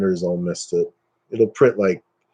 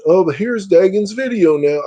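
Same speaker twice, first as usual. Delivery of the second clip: higher, slightly louder mocking announcer; mock presenter; 9 semitones higher, 4 dB louder than usual. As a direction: hand over with put-on cheer. Mimics an ad handing over to video.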